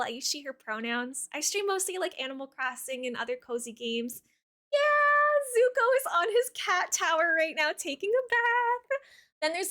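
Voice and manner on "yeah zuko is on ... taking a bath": excited gushing. Real, not acted. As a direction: squeal about the cat. Gushing about her cat.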